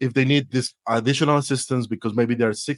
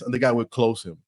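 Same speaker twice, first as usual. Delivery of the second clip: normal talking voice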